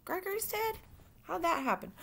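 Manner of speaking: high, soft, startled questions; high, hushed disbelief; shocked disbelief. Acted. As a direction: catch your breath at the news. Shocked reply to the news.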